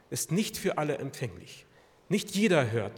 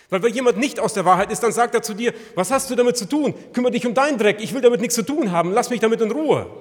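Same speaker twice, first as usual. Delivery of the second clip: loud, high, indignant rebuff, faster; mock brush-off; 7 semitones higher, 10 dB louder, faster than usual. Preacher voicing an unbeliever's loud angry brush-off. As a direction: snap back like someone told off. Worldly person rebuffs rebuke.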